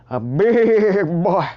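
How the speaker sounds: slow, drawn-out country twang; country accent. Acted. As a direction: stretch it out big and country. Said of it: "I went country on y'all".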